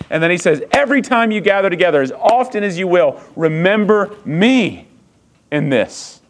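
high, loud, driving preacher's cry; climbing to a fervent sermon peak; preaching at full force. Acted. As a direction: preach it out to the whole room.

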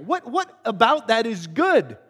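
stuttered, indignant question hammering out each word; exasperated disbelief. Acted. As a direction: demand it as if ready to choke him. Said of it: "wanna just choke the guy and go".

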